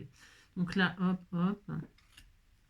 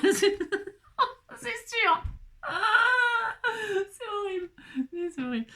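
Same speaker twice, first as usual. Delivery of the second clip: high, mock crying wail; hammed-up lament; 11 semitones higher than usual. Mock despair over card face. (pleurnichement pour rire)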